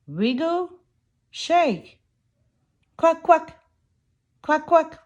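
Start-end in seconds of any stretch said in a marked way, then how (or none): 3.0-5.0 higher, louder quacking; bright cartoon duck quacks. Ducks in farm rhyme.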